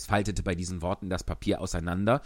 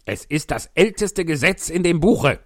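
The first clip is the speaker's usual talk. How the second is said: loud, high blustering king; pompous king; 10 semitones higher, 11 dB louder than usual. Acted. King voiced by reader.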